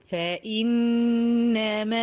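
فإنما with the long a held four counts; four-count madd. Shown as a demonstration. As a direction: hold the madd for four beats. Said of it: "همد أربع حركات". (فإنما بمد أربع حركات)

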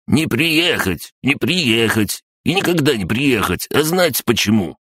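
high-pitched, taunting crab-gnome; gloating. A narrator's reading. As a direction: rub it in with spiteful glee, pitched high. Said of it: "злорадно прошипел". (злорадно, высоким голосом)

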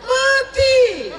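very high loud shriek; shrieked punchline. Acted. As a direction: scream the word. (jeritan tinggi dan keras)

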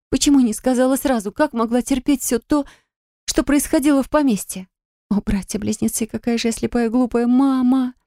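high, tearful, breaking; sobbing lament. Acted. Sher sobs over her mother's secret, turning away. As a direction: grieve aloud through tears. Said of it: "Я всхлипнула".

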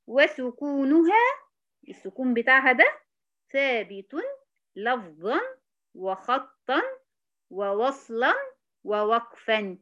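each word stretched with pauses and lifted ends; slow dictation. Shown as a demonstration. Teacher dictating the definition slowly with pauses. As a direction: spell out the list word by word. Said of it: "dictating definition word by word".

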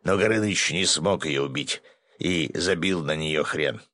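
plain reading voice

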